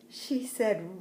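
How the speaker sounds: ordinary delivery, nothing marked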